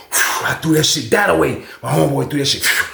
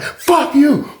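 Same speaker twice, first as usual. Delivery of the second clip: high, angry outburst; imitated defiance; 10 semitones higher than usual. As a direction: act out an inmate yelling back at an officer. Acted defiant reply to lieutenant.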